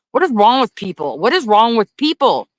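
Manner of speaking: exasperated. Real, not acted. Ranting about harsh traffic laws.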